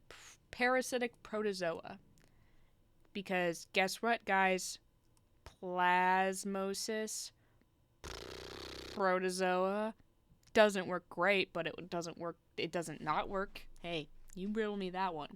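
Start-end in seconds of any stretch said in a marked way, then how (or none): 5.6-9.9 slow, drawn-out words set side by side; sounding out. Shown as a demonstration. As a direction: stretch each word to show the link. Stretching words to show they match.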